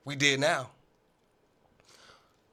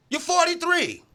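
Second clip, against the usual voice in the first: much higher, louder jab; mocking shout; 13 semitones higher, 5 dB louder than usual. Host mocking Brady's age with a high, loud yell. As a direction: yell it like a heckler in the stands.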